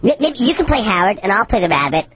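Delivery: sped-up helium cartoon voice. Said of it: "My voice is so high".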